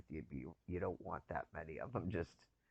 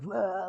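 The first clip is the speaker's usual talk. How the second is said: higher, louder startled "Blah"; mock startled yelp; 13 semitones higher, 10 dB louder than usual. Retelling a mouse jumping on the bed, mock startled yelp. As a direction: blurt it like a mouse just jumped on you. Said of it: "And I just went".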